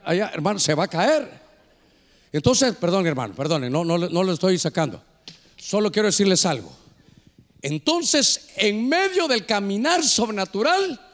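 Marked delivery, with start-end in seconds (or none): none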